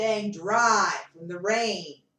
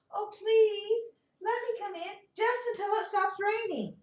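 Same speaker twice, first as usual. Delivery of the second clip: high, small pleading voice; a tiny mouse asking to come in; begging mouse; 8 semitones higher than usual. Acted. Mouse begs the animals for room, high and pleading. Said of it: "running up and says".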